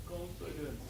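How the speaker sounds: soft, barely voiced confidence; timid confidence; quiet mumbled confidence. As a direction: swallow the word confidence. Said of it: "he said confidence like this".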